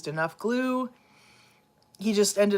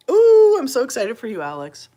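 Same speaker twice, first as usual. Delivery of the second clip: excited; 6 semitones higher, 10 dB louder than usual. Acted. Gushing to pet about iPad.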